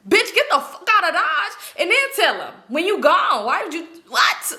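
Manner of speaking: high, incredulous mock scolding; exasperated outburst. Viewer yelling advice at a movie wife, exasperated. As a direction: scold a foolish movie character, high and half-laughing. Imagined warning to movie character.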